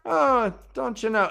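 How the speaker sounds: high-pitched mock Irish lilt. Host imitating Two Rivers folk, Irish lilt. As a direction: put on a high Irish brogue. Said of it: "Two Rivers are supposed to sound like they're I-, Irish".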